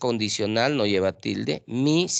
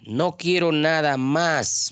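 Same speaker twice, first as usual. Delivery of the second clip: 1 slow example sentence; 4 semitones higher, slower than usual. Shown as a demonstration. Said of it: "más tilde".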